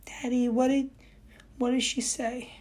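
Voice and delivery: put-on high, anxious child's pleading; scared little boy impression. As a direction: panicky kid tugging his dad. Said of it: "he's going".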